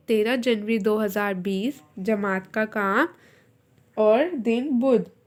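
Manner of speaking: slow, word by word for writing down; slow dictation. Shown as a demonstration. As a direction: dictate it slowly so students can write. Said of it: "ठीक, लिखना". (धीरे-धीरे इमला बोलना)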